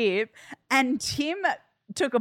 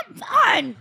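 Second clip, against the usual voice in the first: strained choking voice; 7 semitones higher, 4 dB louder than usual. Retold or imitated. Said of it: "he's like".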